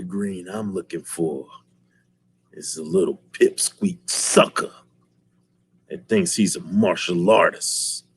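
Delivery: hint of a low threat; low menacing villain. Villain sneering about Leroy to the dad, low menace. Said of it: "He was like".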